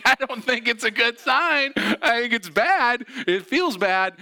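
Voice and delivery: high, loud protest, slow in the middle; pained protest. Retold or imitated. To his cheerful therapist, a high, loud, pained protest. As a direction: push back hard, sounding hurt. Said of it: "I'm like".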